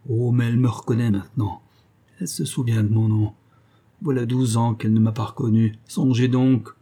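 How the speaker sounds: usual speaking voice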